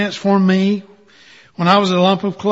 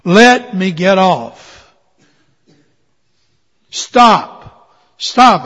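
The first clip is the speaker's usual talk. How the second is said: screaming; 2 semitones higher, 9 dB louder, much slower than usual. Acted. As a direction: yell to be let off the wheel. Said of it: "I screamed".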